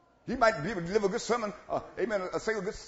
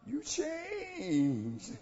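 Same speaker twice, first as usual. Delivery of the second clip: mock accusing partner; 10 semitones higher than usual. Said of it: "imagined partner replies".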